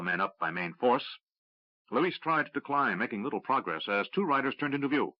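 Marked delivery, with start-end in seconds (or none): none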